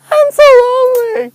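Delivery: very high, loud mock lament; hammed-up lonely wail. Lamenting that ducks ignore him.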